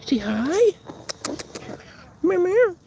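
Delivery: high squeaky meows to a pet. Real, not acted. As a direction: meow at her playfully in a high voice. Talking to cat in pet voice.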